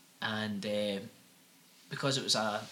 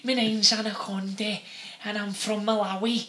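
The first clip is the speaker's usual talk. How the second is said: high, loud hammed-up Liverpool accent; mock Scouse medium; 10 semitones higher, 7 dB louder than usual. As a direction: play the medium channelling in broad Scouse. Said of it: "he has this strong Liverpudlian accent".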